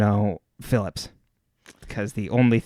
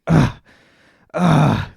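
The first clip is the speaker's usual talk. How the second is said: loud, higher mock grunt of effort; petulant grunting; 4 semitones higher, 7 dB louder than usual. Imitating a petulant Paul Rudd picking up a tray, grunting. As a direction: grunt like a sulking five-year-old. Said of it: "just, like, grunting the entire way".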